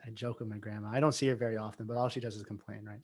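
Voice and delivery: usual speaking voice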